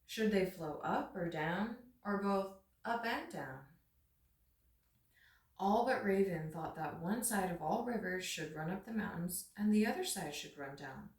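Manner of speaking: usual speaking voice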